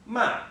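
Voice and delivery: "ma" starting high and dropping quickly; short, falling "ma" from up high; fourth tone. Shown as a demonstration. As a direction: throw "ma" down sharply from a high pitch. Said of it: "demonstrate coming down like this".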